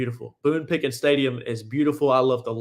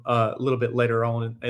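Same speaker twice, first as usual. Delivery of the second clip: ordinary delivery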